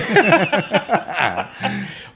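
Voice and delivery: long, high-pitched laugh. Real, not acted. Man amused at being teased for his talk, laughing.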